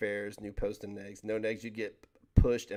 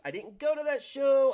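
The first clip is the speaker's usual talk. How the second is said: high, loud sing-song whine; mock lament; 7 dB louder than usual. Streamer mock-wailing about missing the wrestling show, high and loud. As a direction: wail it like a big loss.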